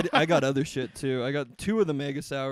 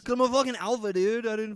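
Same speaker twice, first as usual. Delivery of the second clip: mock alpha boast; 8 semitones higher, 4 dB louder than usual.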